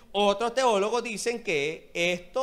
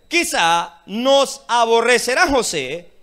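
loud slow quote; 10 dB louder, much slower than usual. Acted. Brothers fear Joseph, slow loud quote.

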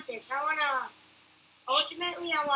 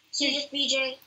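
ordinary talk, nothing marked

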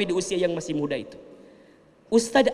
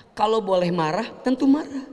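usual speaking voice

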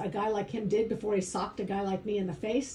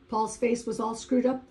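ordinary talk

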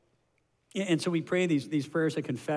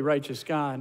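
ordinary delivery

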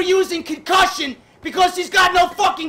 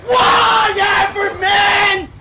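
slow, loud, higher furious yell; raging gamer; 6 semitones higher, 7 dB louder, much slower than usual. Real, not acted. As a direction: drag it out in pure rage. Rage quit moment.

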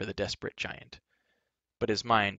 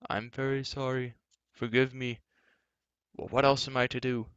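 a lightly voiced remorseful giant; apologetic giant voice; 3 semitones higher than usual. A narrator's reading. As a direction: plead sorry through the stone. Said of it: "Glue's muffled voice".

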